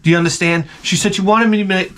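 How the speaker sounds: ordinary talk, nothing marked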